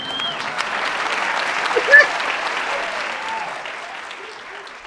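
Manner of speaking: a high, breathy squeal of a laugh. Acted. Tipsy TV chef squealing a laugh at her own joke. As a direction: cackle high and airy at your own joke.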